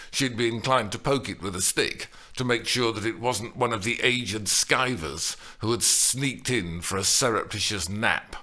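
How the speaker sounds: ordinary delivery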